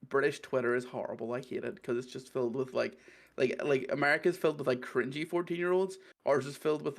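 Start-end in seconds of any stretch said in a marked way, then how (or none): none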